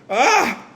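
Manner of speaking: short high yelp while spinning out; acted cry of alarm; spinning yell.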